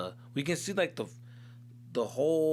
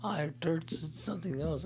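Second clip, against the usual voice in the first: mock scared fumble; 5 semitones higher than usual. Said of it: "I got scared".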